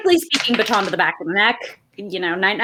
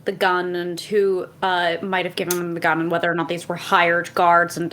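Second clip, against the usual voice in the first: ordinary talk, nothing marked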